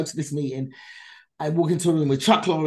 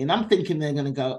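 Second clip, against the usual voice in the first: ordinary talk, nothing marked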